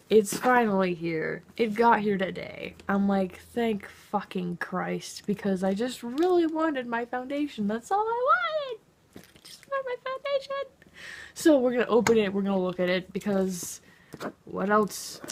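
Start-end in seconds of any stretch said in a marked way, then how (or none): 3.5-5.2 relieved drawl. Retold or imitated. Package finally arrived. 7.7-10.7 whiny high voice. Pretend. Longing for her foundation, she wails in a much higher voice.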